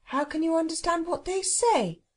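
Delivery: high-pitched sceptical question; doubtful. Acted. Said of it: "said Cyril doubtfully".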